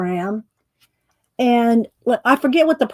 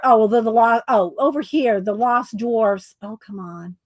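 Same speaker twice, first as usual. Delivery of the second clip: ordinary talk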